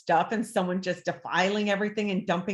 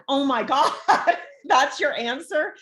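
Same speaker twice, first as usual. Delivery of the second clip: laughing disbelief; 6 semitones higher, 5 dB louder than usual. Retold or imitated. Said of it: "I'm like".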